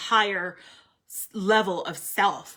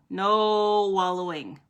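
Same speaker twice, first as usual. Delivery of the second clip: slow emphatic; slower than usual. Shown as a demonstration. Repeating card message slowly.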